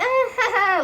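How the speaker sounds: loud, high fake laugh; mocking laugh imitation. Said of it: "Bob is just laugh".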